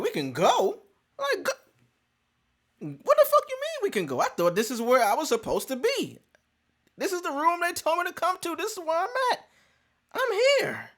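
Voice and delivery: high exasperated disbelief; incredulous. Retold or imitated. Told to leave the room, protesting to the secretary in high disbelief. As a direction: protest in high indignant pitch. Baffled at being moved again.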